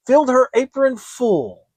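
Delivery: ordinary delivery, nothing marked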